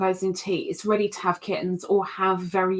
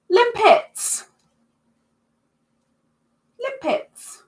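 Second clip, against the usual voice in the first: much higher squeaked "Limpets"; squeaky voice; 13 semitones higher, 5 dB louder, much slower than usual. Pretend. Showing limpet picture, squeals.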